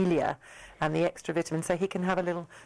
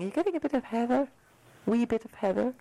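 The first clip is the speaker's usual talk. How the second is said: light mock Scottish accent, quick and a little higher; 4 semitones higher, faster than usual. Host teasing the guest about heather, in a lightly put-on Scottish accent. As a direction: tease the guest in a jokey Scottish lilt. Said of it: "That's my Scottish accent".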